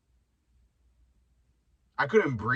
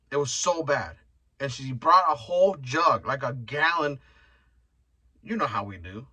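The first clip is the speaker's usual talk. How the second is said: ordinary delivery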